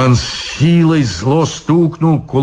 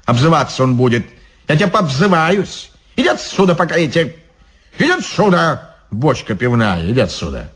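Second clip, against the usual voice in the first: irritated devil guard; 3 semitones higher, faster than usual. Acted. Devil guard chasing the drunk monk away, scolding. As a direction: chase the drunk off.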